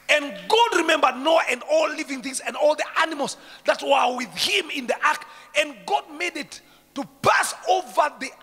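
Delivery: loud high scripture shout; fiery preaching. Acted. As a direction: preach the verse at full force.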